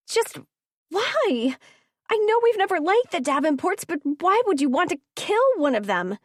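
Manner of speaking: high upset pleading; distressed pleading. A narrator's reading. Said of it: "Ridley cried".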